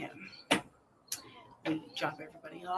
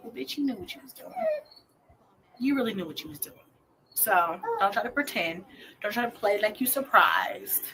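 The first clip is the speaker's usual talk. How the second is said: scolding herself; 4 semitones higher, 6 dB louder than usual. Retold or imitated. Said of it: "It was like".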